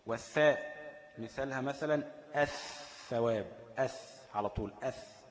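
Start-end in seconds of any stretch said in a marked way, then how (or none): 3.8-5.2 أث said with glottal a straight into a doubled th; sounds out merged th. Shown as a demonstration. Teacher shows students the merged sound, أث with no l. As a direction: say أث with no l in between. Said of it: "مفيش ال".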